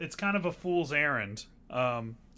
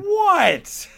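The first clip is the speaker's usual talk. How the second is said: surprised; 10 dB louder than usual. Real, not acted. Reacting to nine out of fifty.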